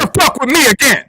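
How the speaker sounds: wild triumphant yelling; loud. Real, not acted. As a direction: yell it out in victory. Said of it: "You don't have to be so loud".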